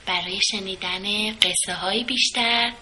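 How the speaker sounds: plain reading voice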